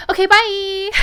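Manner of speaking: a breezy bye to the officers before bolting; chirpy quick goodbye. Retold or imitated. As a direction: sing it out and flee. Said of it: "I turn to the officers and go".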